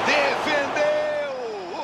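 explosive save call. Acted. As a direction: drag the word out like a stadium call. Big save, drawn-out shout. (narração explosiva de defesa)

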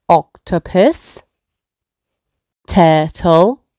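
normal talking voice

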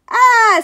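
loud, high scream; sudden high cry of fright; audience scream. A narrator's reading. Audience scared by the stage ghost, screaming. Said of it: "screamed the audience".

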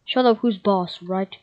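ordinary talk

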